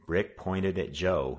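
ordinary delivery

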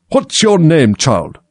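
high, loud demand dropping on the last word; imperious Queen. A narrator's reading. Queen demands of Alice, imperious. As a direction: question a child like a haughty monarch.

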